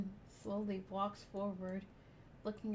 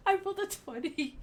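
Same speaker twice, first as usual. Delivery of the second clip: high, loud thrilled cry; excited; 9 semitones higher, 8 dB louder than usual. Real, not acted. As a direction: blurt it out thrilled. Player excited by dice roll.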